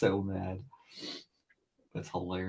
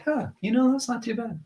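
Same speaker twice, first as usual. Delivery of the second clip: much higher, mildly impressed; pleasantly surprised. Retold or imitated. Artist seeing old drawing later, high pleasantly surprised. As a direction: sound pleasantly surprised by your own work. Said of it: "I'll be like".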